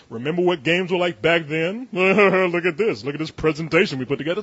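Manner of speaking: smug stage presenter, lightly voiced; mock presenter. Mocking E3 presenter, lightly hammed-up smug pitch. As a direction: play up a hammy keynote host.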